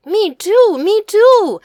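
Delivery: loud, high-pitched eager outburst; bouncing with excitement, loud and high; excited. A narrator's reading. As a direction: chime in like a thrilled child. Boy jumping up and down, thrilled.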